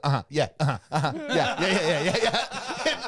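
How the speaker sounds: nervous uh-huh. Retold or imitated. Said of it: "he starts going".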